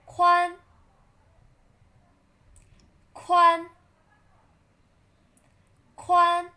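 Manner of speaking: 宽 held high, level and loud; loud high flat 宽 for repeating; first tone 宽. Shown as a demonstration. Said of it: "Read after me".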